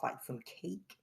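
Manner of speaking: ordinary talk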